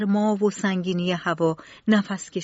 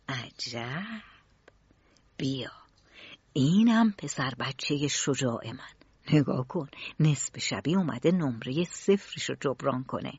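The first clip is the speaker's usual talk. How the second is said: proud murmur; 7 semitones lower, 6 dB quieter than usual. A narrator's reading. Father watching his son at night, proud soft murmur. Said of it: "با افتخار زمزمه کرد".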